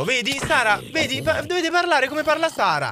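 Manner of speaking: usual speaking voice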